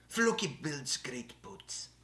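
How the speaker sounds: put-on slow, drawn-out Norse accent; Floki. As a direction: put on a Viking show character's accent, drawing the words out. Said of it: "That's my Floki accent".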